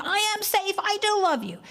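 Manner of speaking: high-pitched defensive shout of I am safe, I do love you; screaming at the child. Shown as a demonstration. Parent screaming denials at an accusing child, shouting. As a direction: yell it back at the child in a panic. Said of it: "you don't scream".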